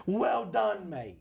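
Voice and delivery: put-on relishing a comic English accent; mock-Cockney, dropping on "mate". Comedian confessing love of the act, English accent. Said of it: "I love doing the English accent".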